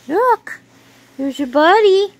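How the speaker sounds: light up, point out the friend; excited. Real, not acted. Owner showing her puppy its buddy, high and excited.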